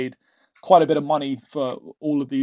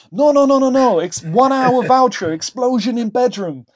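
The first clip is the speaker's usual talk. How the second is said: hammed-up pushy salesman, much higher and louder; imitating the driver; 9 semitones higher, 6 dB louder than usual. Driver insisting on a brothel voucher in a hammed-up loud high sales pitch.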